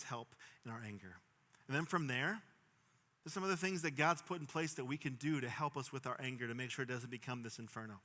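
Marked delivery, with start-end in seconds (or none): none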